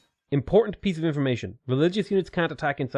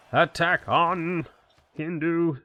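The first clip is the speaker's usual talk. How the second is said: slow, high drawn-out war cry; mock battle cry; 5 semitones higher, slower than usual. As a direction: draw it out high like a playful battle call.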